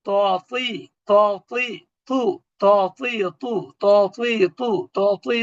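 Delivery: "ত, তি, তু" drilled with rounded, w-coloured t; ত joined with ব. Shown as a demonstration. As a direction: repeat "ত, তি, তু" syllable by syllable, adding ব to ত. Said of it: "ত এর সাথে ব". ("ত, তি, তু" ত এর সাথে ব মিলিয়ে বারবার উচ্চারণ)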